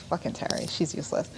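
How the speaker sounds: annoyed under-the-breath whisper; whispered aside. Real, not acted. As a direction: hiss it quietly, fed up with Terry. Annoyed aside about Terry.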